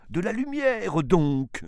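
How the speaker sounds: loud, high theatrical plea; anguished cry. A narrator's reading. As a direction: cry out in exasperated despair, pitch soaring. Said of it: "devient de plus en plus navrant". (cri désespéré, aigu et fort)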